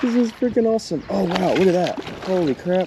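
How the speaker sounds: high, thrilled exclaims; excited. Real, not acted. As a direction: burst out in amazement. Big bass on the line.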